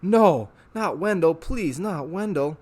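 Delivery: slow, desperate plea; pleading. Acted. Harvey begging Rictus to spare Wendell, slow desperate plea. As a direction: beg for his friend's life.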